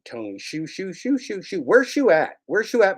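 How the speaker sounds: high sing-song calls of the name; playful sing-song calling. Real, not acted. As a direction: call him out like hunting for a pet. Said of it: "calling out for Tony Shu".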